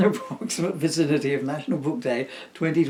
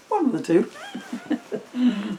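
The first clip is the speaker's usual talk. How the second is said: strained squeak; 10 semitones higher, 4 dB louder, much slower than usual. Pretend. Joking about running out of breath.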